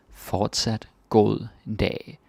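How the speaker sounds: "Fortsat god dag" drawn out slowly, word by word; slow teaching repeat. Shown as a demonstration. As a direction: spell it out slowly for the learner.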